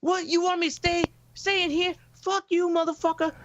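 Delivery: high, shrieking crackhead woman voice; mock angry woman. Jasmine yelling at him to not be left behind, high mock fury. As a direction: play her furious and high. Jasmine angrily refusing to stay.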